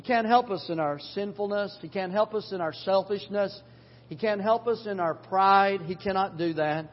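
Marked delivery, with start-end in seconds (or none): none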